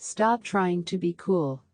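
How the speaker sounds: normal talking voice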